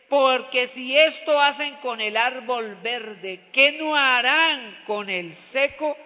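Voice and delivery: slow, strong scriptural declamation; declaimed warning. Acted. Jesus warning the women in scripture, declaimed slowly. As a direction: proclaim it, stretching the key words.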